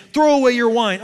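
acted stern command; demanding. Preacher reads Eli scolding Hannah, high loud demand. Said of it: "he demanded".

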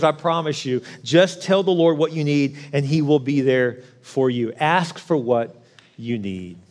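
ordinary talk, nothing marked

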